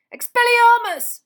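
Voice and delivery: very high, very loud incantation; shouted spell. A narrator's reading. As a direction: yell the spell at full force. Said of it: "he croaked".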